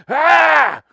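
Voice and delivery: sharp high mock yelp of fright; retold scream. Telling a friend about the movie, a loud mock scream. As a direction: belt out a hijacker's scream as he's sucked out.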